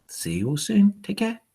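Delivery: plain reading voice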